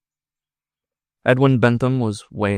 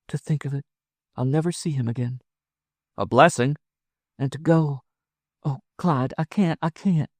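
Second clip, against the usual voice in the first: high woman's voice, distressed; torn, pleading woman; 7 semitones higher than usual. Acted. Wife torn over leaving her husband, high and anguished. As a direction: waver and plead, pitched up high.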